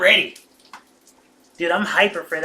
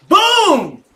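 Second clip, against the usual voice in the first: high, loud "Boom!" of triumph; triumphant shout; 14 semitones higher, 13 dB louder than usual. Retold or imitated. Retelling his instant success to the viewers, loud triumphant shout. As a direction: shout it like you nailed it. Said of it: "I was just like".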